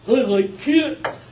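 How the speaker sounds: high, loud, slow puppet voice; Alf voice. Retold or imitated. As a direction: do the alien puppet. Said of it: "some people remember Elf".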